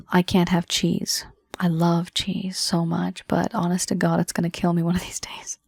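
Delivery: fading to a whisper. Real, not acted. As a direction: let it drop to a hushed whisper. Confiding about cheese.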